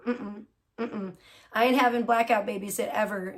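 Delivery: ordinary delivery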